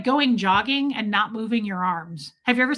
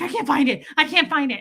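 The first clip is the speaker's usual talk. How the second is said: higher, frantic winded repeats; mock out of breath; 5 semitones higher, 4 dB louder than usual. Streamer acting out a fruitless search for viewers, winded. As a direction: act panicked and worn out from running. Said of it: "feel like I'm actually running".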